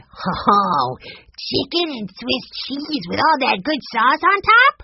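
put-on high, drawn-out eager kid voice; delighted young boy. A narrator's reading. Benny hears of brother's specialty, slow high delighted voice. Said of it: "Benny grinned".

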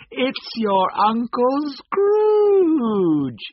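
high, loud, long-drawn triumphant cry. A narrator's reading. Sister solving the guessing game, crying out in triumph. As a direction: shout the answer, stretching out the last word. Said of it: "she cried out".

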